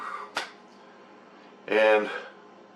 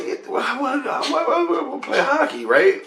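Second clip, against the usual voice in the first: very high, stammered protest; mock baffled sputtering. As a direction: squeal it in exaggerated confusion. Sputtering reply to sister's warning.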